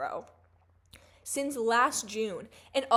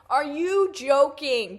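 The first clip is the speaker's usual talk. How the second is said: louder, slower, stretched incredulity; outraged disbelief; 4 semitones higher, 8 dB louder, slower than usual. Real, not acted. Venting to viewers about the leering man, slow outraged disbelief. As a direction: really lean into the disbelief.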